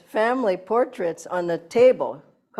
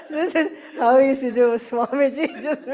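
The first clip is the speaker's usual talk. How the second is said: laughing; 5 semitones higher than usual. Real, not acted. Laughs while recalling Swamiji.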